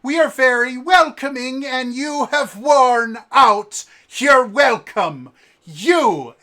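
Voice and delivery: loud, high, seething scold; mocking her own inn's welcome, yelling; furious innkeeper. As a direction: tear into the guests.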